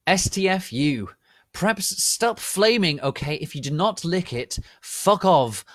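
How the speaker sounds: loud, high, furious author's note; angry rant. Acted. Author's note ranting at haters.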